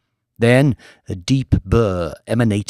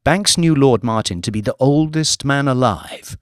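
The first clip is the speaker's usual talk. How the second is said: usual speaking voice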